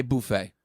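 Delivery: buffet with a "boo" first syllable; boo-FAY pronunciation. Real, not acted. Host owning up to his pronunciation, buffet as "boo-FAY". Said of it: "You're saying buffet".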